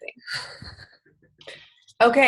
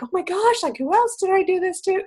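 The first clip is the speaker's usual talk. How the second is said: high, pitched-up worried exclaim; mock dismay; 12 semitones higher than usual. Remembering messages she sent, high worried exclaim. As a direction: squeak it out in dismay.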